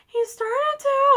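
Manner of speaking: high, slow mock wail; mock tearful. Girl answering mom about the story, mock tearful. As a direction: drag it out like a tearful kid. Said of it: "I was, like, in tears".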